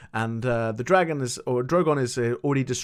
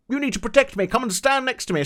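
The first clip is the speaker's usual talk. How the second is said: mock Cersei; 9 semitones higher, faster than usual. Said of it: "Cersei goes like".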